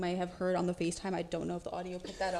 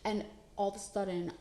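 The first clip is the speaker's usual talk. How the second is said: ordinary talk, nothing marked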